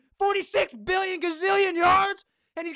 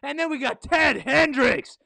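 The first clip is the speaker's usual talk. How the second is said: ordinary delivery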